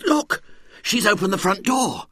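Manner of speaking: put-on high, excited boy's cry. Ginger telling friends the door opened, excited cry. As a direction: point and exclaim.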